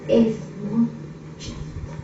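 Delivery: trailing off into a whisper on chiquito. Shown as a demonstration. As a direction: drop the last word to a breathy hush. Repeats phrase, dropping to whisper.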